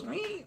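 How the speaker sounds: tiny, very high, soft squeak; mock dolphin squeak; dolphin squeal. Streamer copying Jim Carrey's dolphin bit, soft high squeal. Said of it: "he's just like".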